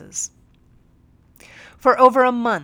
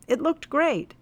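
usual speaking voice

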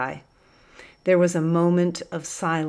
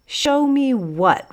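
slow, higher and louder shout; raving; 8 semitones higher, 5 dB louder, slower than usual. A narrator's reading. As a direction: sergeant yelling back at the prisoner. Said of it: "raved the sergeant".

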